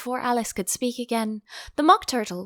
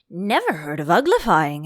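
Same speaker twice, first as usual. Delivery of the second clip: louder, astonished Gryphon; exclaiming; 2 semitones lower, 6 dB louder than usual. Acted. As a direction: burst out in disbelief. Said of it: "it exclaimed".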